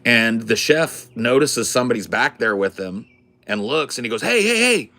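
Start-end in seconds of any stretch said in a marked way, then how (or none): 4.2-4.9 high, loud retold protest; alarmed scolding. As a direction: shout to stop someone behind the counter. Retold chef catches customer behind counter.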